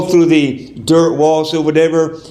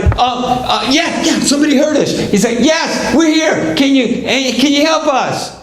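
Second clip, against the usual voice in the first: high, hurried, relieved shout back; acted excited calling; 9 semitones higher than usual. Family heard at last by passerby, excited high calling. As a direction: answer the rescuer eagerly in a high voice.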